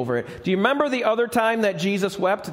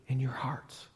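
soft breathy whisper; 4 semitones lower, 11 dB quieter than usual. Acted. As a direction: hush it like a secret. Jesus voiced, whispered line.

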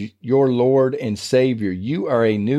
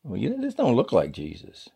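mock Peter, softer and puzzled; a hint of a doubtful disciple, dropped softer; 6 dB quieter than usual. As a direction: play Peter squinting at a stranger, unsure. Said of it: "he looks up and goes".

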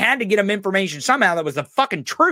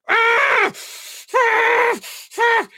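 put-on angry kid meme scream; much slower than usual. Imitating the angry-kid meme, high shrieks. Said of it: "that meme where the kid's like".

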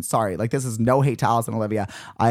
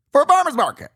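high, loud disbelieving squeal on farmer's market; outraged high-pitched echo of farmer's market; incredulous repeat; 13 semitones higher, 5 dB louder than usual. Real, not acted. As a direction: repeat it like you can't believe it. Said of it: "repeats the phrase in disbelief".

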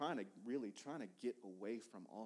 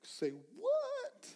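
astonished; 10 dB louder than usual. Retold or imitated. Surprised reply to her confession.

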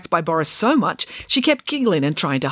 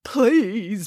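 drawn-out, higher plea; imploring manager; begging; 4 semitones higher than usual. A narrator's reading. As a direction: beg with the whole word. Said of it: "begged the manager".

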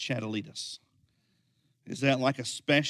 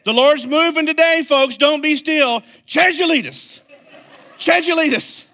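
much higher, loud mock proclamation; hammed-up preacher; 13 dB louder than usual. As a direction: belt it like a one-word revival chant. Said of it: "in his innocent mind".

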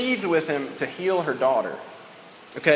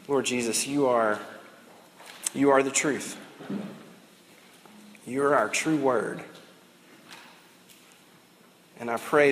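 calm, lower closing prayer; 3 semitones lower than usual. Acted.

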